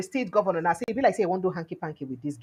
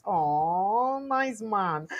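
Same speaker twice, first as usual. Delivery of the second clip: usual speaking voice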